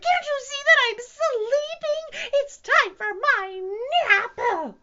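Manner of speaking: very high, slow, loud squeal; outraged squeaky bird; baby bird. A narrator's reading. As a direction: whine it loudly like a cross little bird. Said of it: "said the baby bird".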